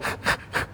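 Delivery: soft voiceless rasp from the throat; guttural throat-clearing sound. Shown as a demonstration. Teacher showing congregation how to say chesed, guttural rasp. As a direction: demonstrate a short repeated Hebrew "kh" rasp. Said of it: "you have to do a little".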